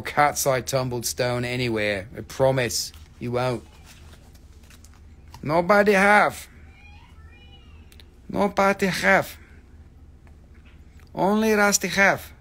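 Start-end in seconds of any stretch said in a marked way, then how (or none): 5.4-12.3 high, loud broken-English sales boast; mock hawker. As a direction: call it out like a market hawker.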